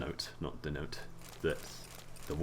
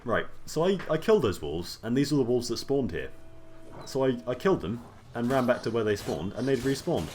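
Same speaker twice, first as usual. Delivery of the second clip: excited; 6 semitones higher, 4 dB louder than usual. Real, not acted. Excited rant over respawned wolves.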